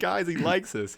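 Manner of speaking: high-pitched, thrilled aside to friends; giddy delight. Retold or imitated. Prankster repeating pilot's approval to team, high giddy delight. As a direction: repeat it with childlike glee, without shouting.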